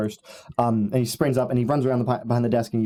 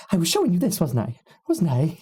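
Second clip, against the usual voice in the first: a hint of a higher teasing voice; lightly voiced character; 5 semitones higher than usual. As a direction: tease it lightly, fishing for praise. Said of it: "And he goes".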